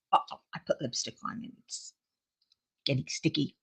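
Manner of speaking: hushed whisper. Real, not acted. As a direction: whisper it under breath. Lipstick sticky on lips.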